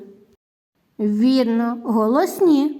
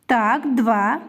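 normal talking voice

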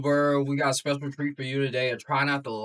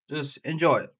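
ordinary talk, nothing marked

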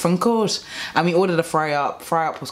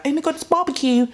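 mock gushing customer; 12 semitones higher than usual. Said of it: "making you think that".

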